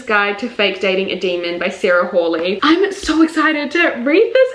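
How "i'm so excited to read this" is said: can't contain the excitement; real excitement. Real, not acted. Revealing an anticipated release, an eager gush.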